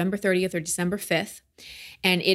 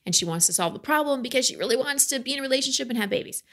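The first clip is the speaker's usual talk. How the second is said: fast, higher, all in one breath; rushed breathless run; 6 semitones higher, faster than usual. Shown as a demonstration. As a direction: rattle it off without stopping for air. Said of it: "I'm out of breath just saying it".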